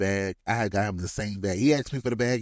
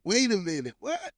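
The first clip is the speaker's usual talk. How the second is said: incredulous rise into a squeaky "what"; retold disbelief; 9 semitones higher than usual. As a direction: act out a startled double take. Retells spotting the minivan again.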